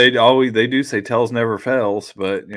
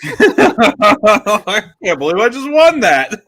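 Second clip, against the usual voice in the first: high, slow words through laughter; reacting to the story, laughing; 10 semitones higher, 7 dB louder, slower than usual. Real, not acted. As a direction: laugh while you talk.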